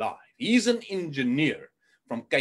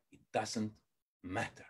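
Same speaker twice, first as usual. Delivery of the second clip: ordinary talk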